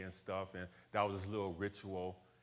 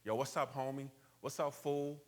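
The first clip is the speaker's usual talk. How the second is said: California street talk; 5 semitones higher than usual. Shown as a demonstration. Showing how people in California talk, in a higher LA street voice. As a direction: greet him like a California homie, a bit higher. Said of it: "So they talk like this".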